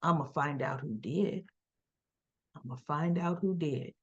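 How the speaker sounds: low, soft, steady threat; low vow. Retold or imitated. Sonny vows to find the shooter, low and quiet.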